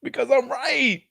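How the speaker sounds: high, crowing gloat. Real, not acted. Co-host rubbing in that he won, gloating. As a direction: crow it in a high pitch. Gloating after winning the argument.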